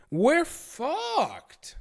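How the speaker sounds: high, drawn-out doomed wail; comic despair. Acted. Streamer learning of cancer risk from shrimp, comic despair. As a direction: wail it like all is lost.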